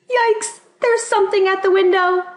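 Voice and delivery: high, loud alarmed cry; scared kid spotting something at the window at night; frightened. Acted. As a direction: gasp it out like something is outside.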